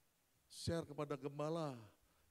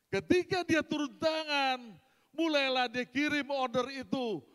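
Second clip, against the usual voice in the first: loud, high preaching climax; booming testimony to the congregation, full force; 5 semitones higher, 12 dB louder than usual. Acted. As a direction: preach it to the back row.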